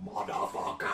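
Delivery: a growled metal-singer curse. Retold or imitated. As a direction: bark it like a thrash frontman. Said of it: "give it that [name] twist".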